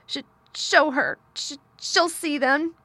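high, loud, stammering sobs; gasping between sobs. A narrator's reading. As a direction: gasp it out, catching on the first sound. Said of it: "he gasped out between two sobs".

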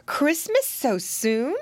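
sleepy mother caught by surprise; surprised question. A narrator's reading. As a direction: stretch it into an astonished question. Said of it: "exclaimed Missus Twistytail".

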